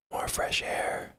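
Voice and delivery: slow, eerie whisper; creepy whisper. Retold or imitated. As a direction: whisper it slowly like a voice in someone's head. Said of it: "he just hears me like".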